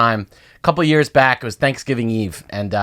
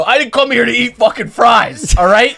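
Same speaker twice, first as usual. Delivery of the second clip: high, loud and slow indignant rant; mock angry outburst; 8 semitones higher, 3 dB louder than usual. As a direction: scold the table like an offended drinker. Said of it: "you get all mad".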